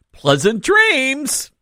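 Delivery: high, drawn-out fake good wishes; mock-sweet taunt. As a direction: stretch it out high, needling the neighbor. Taunting the neighbor under spotlights.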